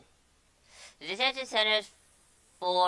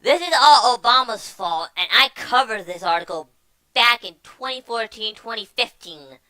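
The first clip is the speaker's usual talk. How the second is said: loud, worked-up rant; 4 semitones higher, 10 dB louder than usual. Real, not acted. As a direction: hammer it home loudly. Ranting about Obama's fault.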